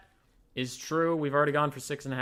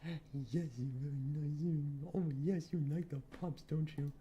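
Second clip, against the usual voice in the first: softer cooing for the dog; soft pet talk; 5 dB quieter than usual. Real, not acted. Owner petting his dog, soft cooing. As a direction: gently praise the pup.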